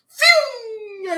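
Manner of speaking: sonido de Sonic, agudísimo y fuerte; Sonic whoosh. Pretend. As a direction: grita el fium como Sonic pasando a toda velocidad. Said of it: "cuando acabé de hacer el fium ese". (imita a Sonic con un fium agudo y fuerte)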